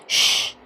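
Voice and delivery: "ش" as a single sh sound; SH as one sound. Shown as a demonstration. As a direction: sound out "ش" alone, one unvoiced sh. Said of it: "ادوني صوت واحد اللي هو". (نطق "ش" كصوت واحد)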